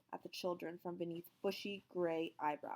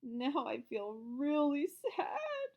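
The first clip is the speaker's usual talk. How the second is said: put-on slow, lightly sobbing child voice; a hammed-up little sob; mock sob; 8 semitones higher, 3 dB louder, much slower than usual. A narrator's reading. Boy sobbing about the teacher leaving, mild and slow. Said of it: "sobbed Calvin".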